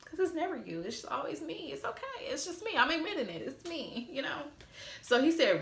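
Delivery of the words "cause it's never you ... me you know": mock self-blame. Wife sarcastically taking all the blame from husband, mock self-blame. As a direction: take the blame in a high, put-on little voice.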